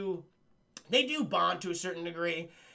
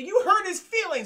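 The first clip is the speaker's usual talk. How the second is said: high reproachful voice; 11 semitones higher, 8 dB louder than usual. Retold or imitated. Bridget Fonda voiced.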